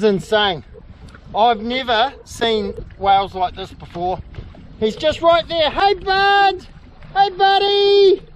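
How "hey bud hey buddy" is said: high, loud, slow friendly call. Real, not acted. Kayaker hails the surfacing whale, loud friendly call. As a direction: stretch the greeting out over the water.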